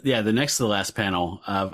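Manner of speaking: usual speaking voice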